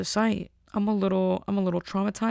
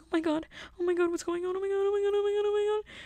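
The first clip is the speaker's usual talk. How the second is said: very high, fast panicked squealing; 13 semitones higher, faster than usual. Retold or imitated. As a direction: shriek it out in a rush of panic. Stuck in mud, panicking.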